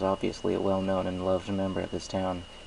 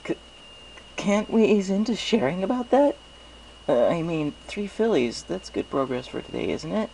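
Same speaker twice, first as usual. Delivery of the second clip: higher, flustered and backpedalling; anxious pony hoping to change the subject; nervous stammer; 10 semitones higher than usual. Acted. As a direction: squirm out of it with a nervous laugh in the voice. Flustered hero dodging the topic.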